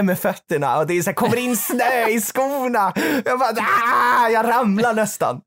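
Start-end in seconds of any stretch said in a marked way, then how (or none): 3.5-4.3 short, loud, high yelp of slipping; a sudden shout as your feet slide; retold yelp of nearly falling in the snow; startled cry.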